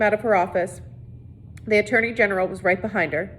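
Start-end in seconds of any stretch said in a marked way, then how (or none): none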